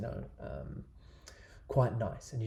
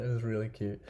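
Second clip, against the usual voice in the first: ordinary delivery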